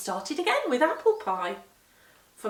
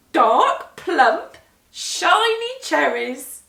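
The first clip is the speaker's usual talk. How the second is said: relishing; 6 semitones higher, 12 dB louder, much slower than usual. A narrator's reading. Molly relishing the cherries.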